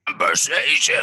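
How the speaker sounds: a hint of his sister's voice; mock her way of saying it; imitating his sister. Brother lightly imitating his sister saying conversation. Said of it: "That's what you sound like to me".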